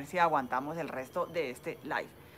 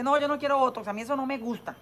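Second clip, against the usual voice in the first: higher, louder mock protest; objecting patient; 7 semitones higher, 5 dB louder than usual. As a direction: voice a patient refusing, pitched up. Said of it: "También hay gente que dice".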